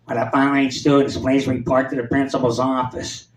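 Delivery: [name]. Retold or imitated. Vice principal calling students to the office, [name] impression. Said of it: "He talked just like [name]".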